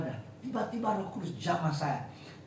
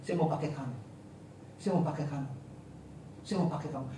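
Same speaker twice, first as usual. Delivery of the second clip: voice of the Holy Spirit; 3 semitones lower, 6 dB quieter than usual. Retold or imitated. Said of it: "saya dengar suara Roh Kudus".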